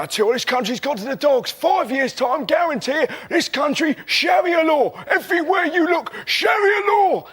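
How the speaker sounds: a pub bigot working himself into a frenzy; ranting bigot. Retold or imitated. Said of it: "just sat there going".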